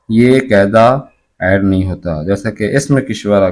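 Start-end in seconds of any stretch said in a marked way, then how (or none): none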